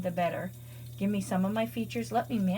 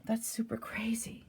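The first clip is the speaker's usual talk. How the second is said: slow, breathy whisper; hushed disbelief; 3 semitones higher, 7 dB quieter than usual. Real, not acted. Watching her new machine fail, she whispers in disbelief. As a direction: let it slip out under her breath. Stunned at useless wash cycle.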